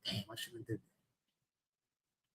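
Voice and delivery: whispered to oneself, low and slow; regretful whisper. Retold or imitated. Preacher voices the regret after acting in anger, whispered. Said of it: "you know what we say?".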